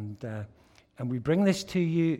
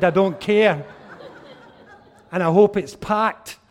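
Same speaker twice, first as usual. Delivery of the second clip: loud, high defiant shout with a laugh; defiant excited shout; 6 semitones higher, 12 dB louder than usual. Retold or imitated. Defiant reply in retold story.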